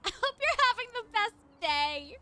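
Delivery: high, loud, laughing well-wish; gushing. Real, not acted. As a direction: beam it at him through a laugh. Gushing at a viewer.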